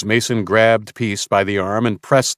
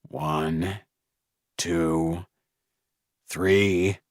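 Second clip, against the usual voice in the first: softer, slow menacing count; cold quiet countdown; 2 semitones lower, 6 dB quieter, much slower than usual. Acted. Mason counting down at Samuel, quiet menace.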